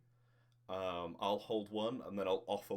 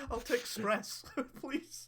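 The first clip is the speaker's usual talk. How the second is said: laughing; much slower than usual. Acted. Player asks formally, laughing.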